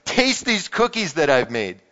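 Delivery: lively read-aloud with voiced characters; animated picture-book reading; storybook reading. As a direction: read it like a children's story to the congregation. Reads Frog and Toad aloud.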